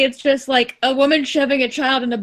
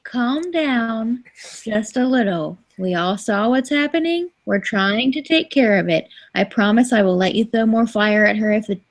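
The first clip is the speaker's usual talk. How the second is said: calming; 4 semitones lower than usual. Acted. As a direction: talk down an angry pixie gently. Soothing the angry pixie mentally.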